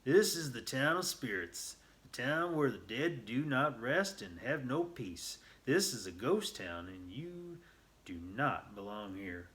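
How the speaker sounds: put-on loud spooky old miner; old man's ghostly warning. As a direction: warn them off like a ghost of the saloon. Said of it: "The old man finally spoke".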